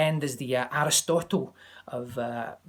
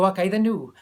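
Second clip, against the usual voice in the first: "Och, aye, the new" punched louder and higher as a cliché; overdone Scottish cliché; 4 semitones higher, 6 dB louder than usual. Shown as a demonstration. Teacher showing the clichéd wrong Scottish accent, a louder ham. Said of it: "they get it wrong by trying to do".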